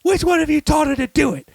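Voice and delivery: high-pitched mock outrage; accusing character impression. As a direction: interrogate them in a hammy high voice. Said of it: "he goes".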